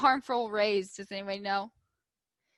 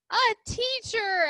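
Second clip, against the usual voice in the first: high, slow, eager chorus; eager class answer; 10 semitones higher, 4 dB louder, slower than usual. A narrator's reading. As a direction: sing it out like a whole class. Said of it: "everyone responded".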